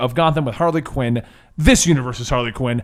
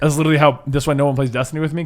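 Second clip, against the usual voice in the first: ordinary talk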